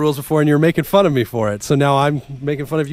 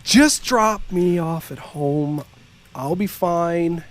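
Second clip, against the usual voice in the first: dramatic reading. Acted. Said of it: "dramatic read".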